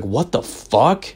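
slow, drawn out and stunned; stunned disbelief. Retold or imitated. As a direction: let the shock stretch every word. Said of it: "And I was like".

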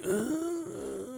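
high, strained mock groan; drawn-out high noise of mock panic; tongue-tied groan. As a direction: pretend to freeze up, wordless. Acting out freezing before a girl.

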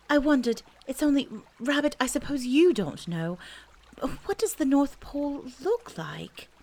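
a hint of a boy's shy question; Christopher Robin, hesitant. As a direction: ask it high and tentative.

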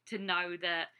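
ordinary delivery

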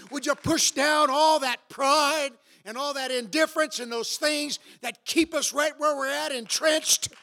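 high, loud pleading preacher; altar call preaching. Real, not acted. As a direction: call them forward with fervor.

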